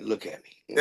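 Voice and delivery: low, soft, commanding; low intimate command. Retold or imitated. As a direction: murmur it low and firm. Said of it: "grab the back of that, like".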